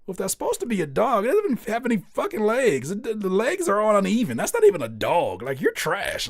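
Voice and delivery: high, loud, fast mocking rant; harsh dad critique. Dad loudly trashing his daughter's dog drawing, fast and high. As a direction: tear the drawing apart. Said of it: "And he's like".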